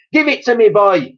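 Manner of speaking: high, loud yell. A narrator's reading. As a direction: snarl at the boy. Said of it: "yelled Grandma".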